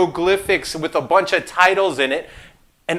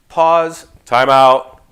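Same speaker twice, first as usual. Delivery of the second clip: calling time out; 5 semitones lower, 6 dB louder, much slower than usual. Acted. Calling a stop to himself.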